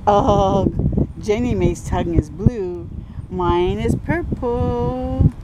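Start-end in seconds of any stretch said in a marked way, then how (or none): none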